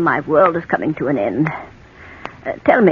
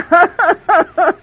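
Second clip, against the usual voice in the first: high loud tittering laugh; shrill giggle; 13 semitones higher, 7 dB louder than usual. Acted. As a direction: laugh off his dark joke.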